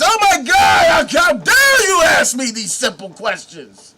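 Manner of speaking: how-dare-you fury; offended shout. Acted. Salesman offended by simple question.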